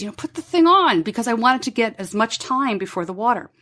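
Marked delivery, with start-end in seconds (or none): 0.2-1.0 sharp, higher, impatient nag; impatient mother telling son to put it on; agitated. Retold or imitated. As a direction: snap at the kid to hurry up. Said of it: "But I was getting agitated".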